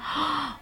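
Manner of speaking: soft, sudden intake of breath; real gasp of surprise; shocked gasp. Real, not acted. Shocked by Arabian result.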